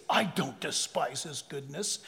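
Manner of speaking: low, slow, mock-innocent churchgoer voice; dragged-out mock protest of innocence; mock denial. Preacher voicing a churchgoer's denial, slow and low.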